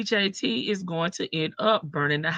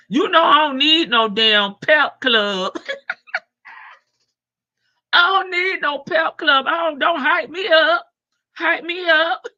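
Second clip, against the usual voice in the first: loud, higher protest through laughter; laughing shout; 6 semitones higher, 6 dB louder than usual. Real, not acted. Host waving off the chat's hype, laughing loudly. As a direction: cackle it out at the hype crew.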